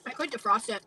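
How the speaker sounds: chewing edamame while talking; words round a mouthful; mouth full. Real, not acted. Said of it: "I don't wanna see the fucking edamame in your mouth".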